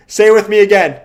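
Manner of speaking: loud, high preacher-style order; hammed-up cult leader barking orders; mock cult command.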